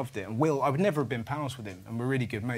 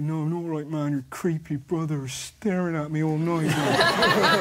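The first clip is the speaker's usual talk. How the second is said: imitating Will; 3 semitones higher, 5 dB louder than usual. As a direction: complain like a put-upon mate. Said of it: "He goes".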